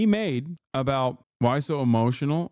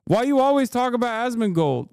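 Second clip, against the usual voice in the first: mocking chat; 9 semitones higher, 4 dB louder than usual. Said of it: "I have a game".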